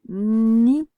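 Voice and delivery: ordinary talk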